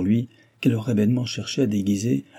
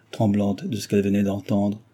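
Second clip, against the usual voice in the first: ordinary delivery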